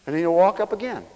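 usual speaking voice

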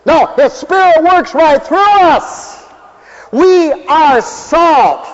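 high, loud, slow preacher's cry; fervent preaching. Acted. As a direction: hammer the message home like a revival preacher. Preaching at full force.

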